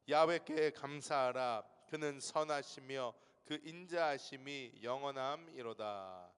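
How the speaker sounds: slow, loud pulpit reading; solemn scripture reading. Acted. Pastor reading Colossians to congregation, slow solemn proclamation. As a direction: proclaim the verse slowly. Reads psalm.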